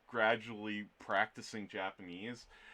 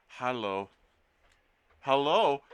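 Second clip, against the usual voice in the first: mock hello; 2 semitones higher, 8 dB louder than usual. Greeting in German.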